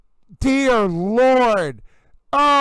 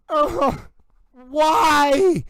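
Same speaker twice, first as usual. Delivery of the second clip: ordinary talk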